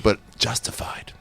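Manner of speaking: hushed, slow whisper; whispered aside. Acted. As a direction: whisper it like a secret. Dark joke whispered aside.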